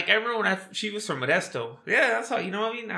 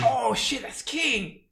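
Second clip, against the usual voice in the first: excited recognition; 8 semitones higher, much slower than usual. Retold or imitated. Retold excitement at spotting King.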